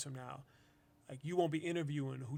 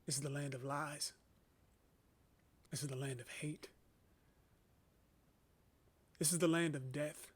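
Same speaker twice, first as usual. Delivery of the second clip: softer, measured spoken-word delivery with long pauses; poem recitation; 8 dB quieter than usual. Acted. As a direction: let each line land, then pause.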